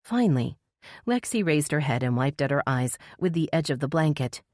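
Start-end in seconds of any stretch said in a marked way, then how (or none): none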